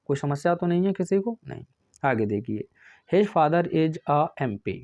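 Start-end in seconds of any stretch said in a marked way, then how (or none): 3.1-4.7 "a MP" read slowly and evenly as in the question; slow dictation. Shown as a demonstration. Teacher reading out the question sentence, slow and deliberate. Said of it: "sir एम हमारा तो क्या है".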